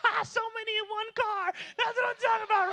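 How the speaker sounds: high, giggling squeal; acted cackling mockery; hammed-up mocking laughter. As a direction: laugh all through the teasing. Mocking crowd laughing at others.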